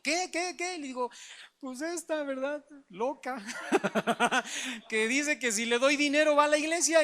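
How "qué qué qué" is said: high, startled repeated what. Retold or imitated. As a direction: jump in surprised, pitch high. Mamá escucha sorprendida. (sorpresa aguda, repitiendo qué)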